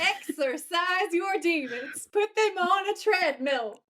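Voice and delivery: mock command. Jokey chant on exorcise slip.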